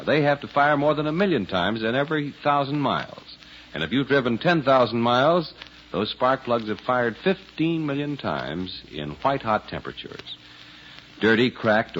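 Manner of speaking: usual speaking voice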